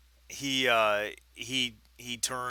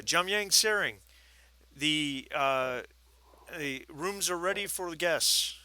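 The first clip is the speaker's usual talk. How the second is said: put-on higher young boy calling; 6 semitones higher, 4 dB louder than usual. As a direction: call out like a teen boy. Boy calls out to lama.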